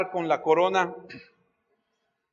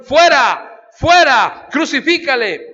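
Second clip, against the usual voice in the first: loud, higher shout, each word falling; mob shout; 5 semitones higher, 8 dB louder, slower than usual. Acted. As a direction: scream it like the crowd at Pilate. Crowd shouts against Jesus.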